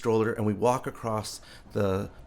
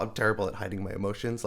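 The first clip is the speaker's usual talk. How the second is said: usual speaking voice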